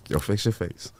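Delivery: ordinary talk, nothing marked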